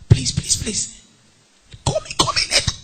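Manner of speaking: whispered secret plea into the phone; hushed, urgent stage whisper; furtive whisper. Acted. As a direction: beg under your breath, acting. Secretly begging caller to ring later.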